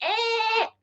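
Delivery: very high, thin squeal, like a pig. Retold or imitated. Correcting his own retelling of being choked, a squealing pig "Ah!". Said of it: "more high-pitched like a pig".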